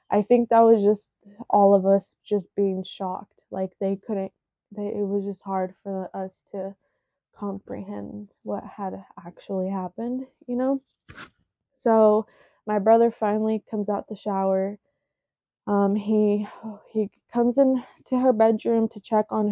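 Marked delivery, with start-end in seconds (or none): none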